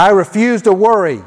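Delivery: firm, projected declaration; like you mean it. Shown as a demonstration. Preacher leads congregation in declarations against worry, firm and meaning it. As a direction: declare it with conviction for a crowd to repeat. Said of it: "say it like you mean it".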